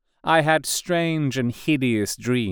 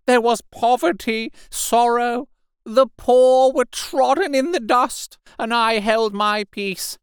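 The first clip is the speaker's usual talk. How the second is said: high, fervent preacher's wail of self-blame; loud ranting repentance; 14 semitones higher, 4 dB louder than usual. A narrator's reading. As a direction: rant like a half-mad curate calling sinners to repent. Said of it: "he began to talk aloud instead of whispering".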